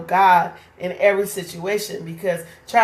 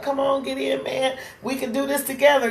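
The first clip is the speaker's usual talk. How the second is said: higher, quicker urging call; urging rescuer; 6 semitones higher than usual. Retold or imitated. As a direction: hurry him aboard.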